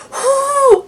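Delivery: high squealing whoo; excited whoo. Real, not acted. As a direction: yelp it out, shocked at the price. Price shock exclamation.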